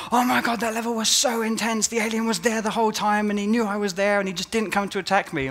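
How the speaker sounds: high, breathless gushing tester; mock-excited QA guy, pitched high. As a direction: gush like a rattled playtester. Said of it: "come back and say".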